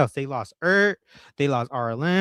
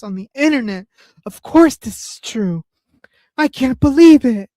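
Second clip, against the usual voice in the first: sarcastic disbelief; 7 semitones higher, 4 dB louder than usual. Acted. Sarcastic praise of liar.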